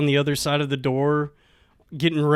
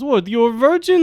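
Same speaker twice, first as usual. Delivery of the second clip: higher mock Russian woman; pitched-up put-on Russian; thick Russian accent; 7 semitones higher than usual. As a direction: imitate her disbelief in a Russian accent. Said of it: "she has, like, a thick Russian accent".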